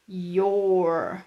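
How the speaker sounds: "your" said as one short syllable, the contraction of you are; teacher models "your" slowly as a single blended sound; contraction you're. Shown as a demonstration. Teacher shows students the contraction, carefully sounded. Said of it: "E de you are, fica".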